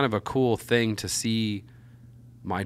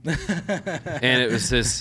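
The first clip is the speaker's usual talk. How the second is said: words dissolving into a laugh; slowed right down by laughter; 6 semitones higher, 5 dB louder, much slower than usual. Real, not acted. Dad laughing as he tells the podcast hosts about his daughter.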